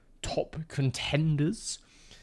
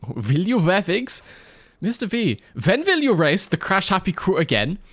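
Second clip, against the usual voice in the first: Mister V read high and loud with V for W, vhen vill; hammy German accent, vhen vill you; mock German accent; 8 semitones higher, 5 dB louder than usual. Shown as a demonstration. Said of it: "so I read that in the".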